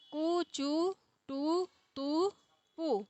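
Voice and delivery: कु, चु, टु, तु drawn out very slowly and softly; a teacher dictating a code to memorize; slow recited code. Shown as a demonstration. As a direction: spell out कु, चु, टु, तु syllable by syllable, slow and quiet. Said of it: "एक code हो गया ये".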